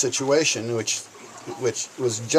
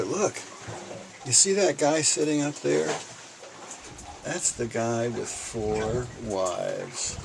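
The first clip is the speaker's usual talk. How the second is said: hushed gossip; 6 dB quieter, slower than usual. Retold or imitated. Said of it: "just a whisper they'll carry".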